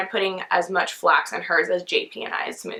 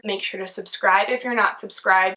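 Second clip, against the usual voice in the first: normal talking voice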